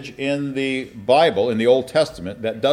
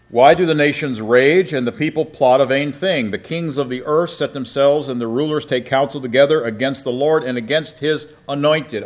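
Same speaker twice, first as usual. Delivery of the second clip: raised, declaiming Bible reading; scripture reading; 4 semitones higher, 6 dB louder than usual. Acted. Preacher reading Psalm two to his class, declaiming. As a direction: proclaim the psalm to the congregation.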